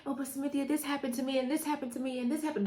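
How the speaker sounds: complaining client. Retold or imitated. Coach mimicking a woman excusing herself with past troubles, small complaining voice. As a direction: imitate a client listing woes, small and high.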